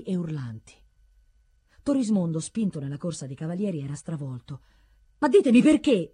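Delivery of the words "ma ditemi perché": loud, high protest; raised voice of distraught appeal; shouted demand. A narrator's reading. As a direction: cry out, demanding an answer. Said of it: "gridava all'anziano".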